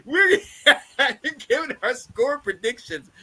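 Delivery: words broken by laughter; laughing. Real, not acted. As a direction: laugh through the line. Laughing through announcement.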